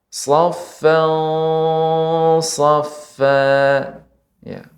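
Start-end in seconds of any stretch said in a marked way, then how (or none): 0.1-3.4 safan said thick and raised, lips not pushed out; thick ikhfa. Shown as a demonstration. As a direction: recite safan, safa with a heavy emphatic s, voice lifted. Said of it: "Maka pengucapannya".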